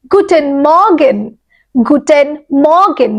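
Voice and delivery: গুটেন মর্গেন spoken slowly and clearly as a pronunciation model; slow careful German guten Morgen for students to repeat. Shown as a demonstration. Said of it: "আমি যেভাবে উচ্চারণ করছি চেষ্টা করবে কথাটাকে এইভাবেই".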